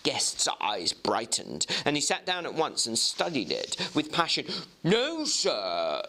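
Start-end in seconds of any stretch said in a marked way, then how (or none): 4.8-6.0 clerk Guest. Acted. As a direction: pipe up politely as a keen young clerk. Guest studying handwriting.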